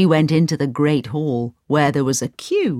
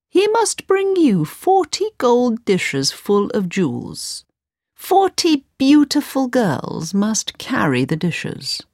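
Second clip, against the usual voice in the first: higher, slow, sly demand; scheming vizier; 7 semitones higher, slower than usual. A narrator's reading. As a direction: lay out the trap slowly and slyly.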